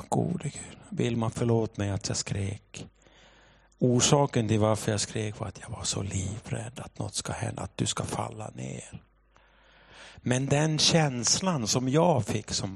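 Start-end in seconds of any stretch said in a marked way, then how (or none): 0.1-9.0 soft, subdued prayer and apology; retold prayer. Father prays and apologises to daughter, soft subdued voice. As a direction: lower the voice into a humble apology. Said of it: "så bad jag".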